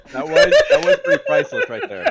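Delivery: high, laughing hard. Real, not acted. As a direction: laugh through the words. Laughs at the phone crash.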